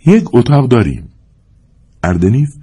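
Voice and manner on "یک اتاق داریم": lightly voiced young woman, higher pitch; sweet-voiced young woman. A narrator's reading. Young woman offers a room to the stranger, sweet-voiced. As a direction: raise the voice to a pleasing young woman's. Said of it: "صدای دلنواز زن جوان برخاست".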